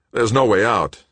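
panicked. A narrator's reading. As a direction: cry it out in panic. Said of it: "looking around wildly".

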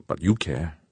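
ordinary delivery

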